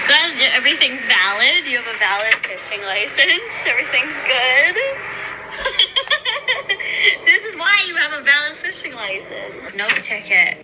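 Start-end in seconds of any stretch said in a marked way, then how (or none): 4.8-7.1 long high laugh. Real, not acted. Friend laughing after the cops drive away, long laugh. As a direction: let a long laugh roll out.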